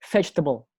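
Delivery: "vegetable" stressed on VEG, falling away after; first-syllable stress. Shown as a demonstration. Teacher giving a second example, "vegetable" weighted on its first syllable. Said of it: "Nah, sama dengan".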